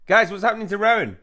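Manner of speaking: high, loud panicked question; alarmed cry. Acted. Reacts to game character being possessed.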